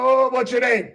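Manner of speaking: usual speaking voice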